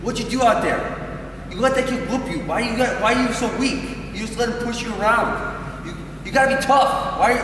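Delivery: going off critically. Acted. Parent criticizing a wrestler after a lost match, exasperated scolding. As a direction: tear into the kid without shouting. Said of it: "we don't wanna go off on them".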